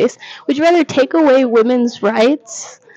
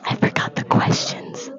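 whisper; 6 dB quieter than usual. Real, not acted. Aside to camera.

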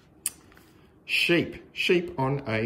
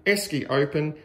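ordinary delivery

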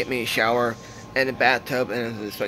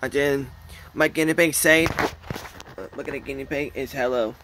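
usual speaking voice

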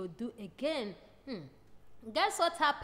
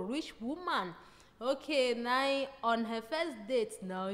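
usual speaking voice

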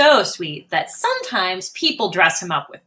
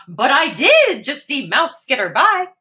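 higher, emphatic elephant voice; 6 semitones higher than usual. Acted. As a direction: stress did like a flustered elephant. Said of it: "says Elephant".

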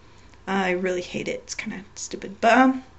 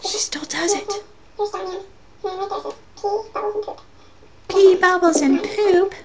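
high squeaky cartoon voice; chipmunk voice; 9 semitones higher, slower than usual. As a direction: pitch it way up like a chipmunk. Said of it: "that whole chipmunk thing".

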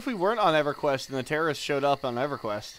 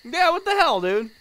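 outraged; 12 semitones higher, 5 dB louder than usual. Acted. Mock outrage at camper.

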